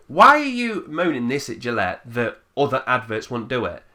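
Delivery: ordinary delivery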